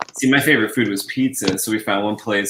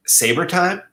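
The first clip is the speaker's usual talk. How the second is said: puzzled question; 7 semitones higher than usual. Pretend. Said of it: "you might have to ask".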